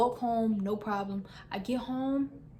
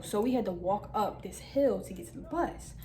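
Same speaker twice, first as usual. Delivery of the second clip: ordinary talk, nothing marked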